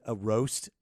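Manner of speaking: usual speaking voice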